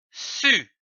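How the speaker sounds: "Su" said slowly and distinctly as a model; clear syllable demo. Shown as a demonstration. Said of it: "C, c'est dit U".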